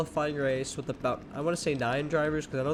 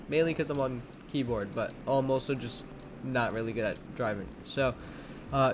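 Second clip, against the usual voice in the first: usual speaking voice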